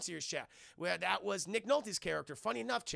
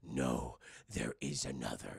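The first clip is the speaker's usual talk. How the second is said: much lower, croaky little master; Yoda voice; 3 dB quieter than usual. Acted. Yoda answering Ben's ghost, low and solemn.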